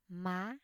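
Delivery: "má" sweeping upward to a high end; "má" in the rising Vietnamese sắc tone. Shown as a demonstration. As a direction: let "má" climb high like a question. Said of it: "High rising tone or thanh sắc".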